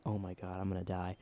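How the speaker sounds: low, soft retold panic; hushed dread. Boy alone as biker pounds the door, hushed dread. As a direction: drop to a small, quiet voice.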